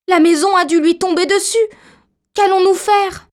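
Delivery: put-on high-pitched, distraught little girl; dismayed. A narrator's reading. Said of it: "consternée". (consternée, voix aiguë)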